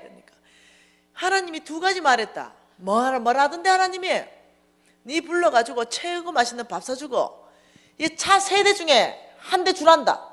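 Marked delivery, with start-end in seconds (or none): 1.1-10.3 high, loud announcement; acted-out insistent yelling with stretched endings; shouting after someone. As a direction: holler the message across a distance. Friend shouts message to departing woman.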